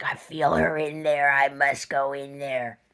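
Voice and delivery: slow eerie put-on voice; spooky imitation. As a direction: intone it like a possessed woman. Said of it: "She's like".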